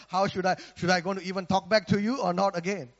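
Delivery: usual speaking voice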